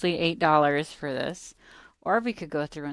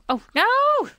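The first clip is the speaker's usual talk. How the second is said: much higher and louder, a sudden yelp; alarmed cry; 11 dB louder than usual. Real, not acted. Gamer losing a slime, high loud yelp. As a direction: cry out as it gets away.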